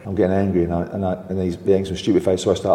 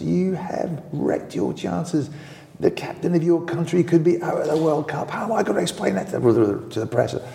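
angry manager; 9 semitones higher than usual. Retold or imitated. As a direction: tear into the players like an old gaffer. Manager Bobby Robson scolding players.

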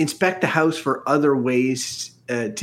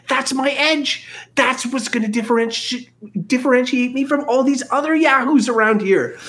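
put-on whiny high young voice; 8 semitones higher than usual. Flea pleads with Archie.

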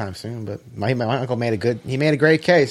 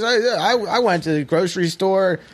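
higher-pitched retelling of the uncle's rant; imitating uncle; 7 semitones higher than usual. Uncle griping about grocery store bags, higher mock rant. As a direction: voice the cranky uncle complaining.

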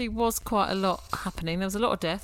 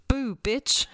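a touch louder, higher sneering demon taunt; 5 semitones higher, 5 dB louder than usual. Retold or imitated. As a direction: spit the taunt like a cheeky demon. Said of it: "And the demon goes".

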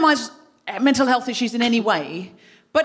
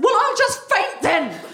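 melodramatic sulk; 9 semitones higher, slower than usual. Retold or imitated. Sulky threat to husband.

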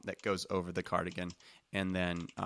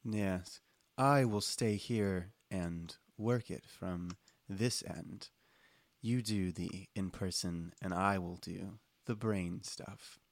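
slow, soft, deliberate delivery; measured librarian agent voice; sleepy office librarian; 6 dB quieter, slower than usual. Acted. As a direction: deliver the briefing slowly and quietly, word by word. Game master voices Connie.

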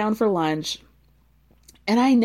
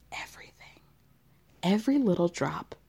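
whisper; 4 semitones lower, 6 dB quieter than usual. Retold or imitated. Quoting Joel's tequila line.

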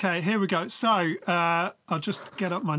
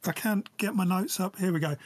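usual speaking voice

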